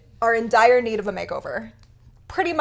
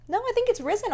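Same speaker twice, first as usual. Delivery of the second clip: ordinary delivery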